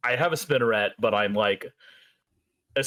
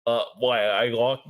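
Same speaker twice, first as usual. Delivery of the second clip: usual speaking voice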